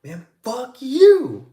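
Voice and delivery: loud, high, stretched-out yell; retold angry shout. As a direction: re-enact screaming back at the parent. Retells yelling back at the parent.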